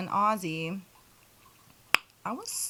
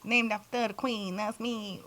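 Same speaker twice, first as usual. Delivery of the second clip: usual speaking voice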